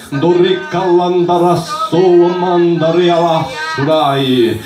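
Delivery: slow, fervent tongues over music; speaking in tongues. Real, not acted. Preacher praying in tongues before the congregation, fervent. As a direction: pour out ecstatic tongues in prayer.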